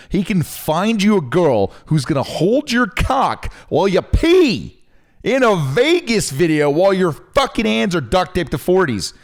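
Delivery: high and loud, ranting at his cohost; mock-furious rant. Real, not acted. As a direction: yell it in half-joking outrage. Arguing podcast cohost ranting at partner.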